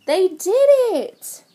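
high, loud celebration; triumphant storytime shout; cheering. Real, not acted. Cheering the freed trucks.

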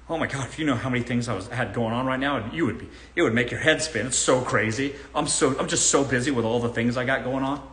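lightly voiced, fast bragging broke guy; broke guy on Tinder. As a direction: rattle it off fast like a guy faking being busy. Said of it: "Here's my impression of a broke guy".

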